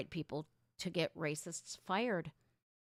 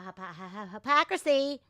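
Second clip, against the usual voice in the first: ordinary talk, nothing marked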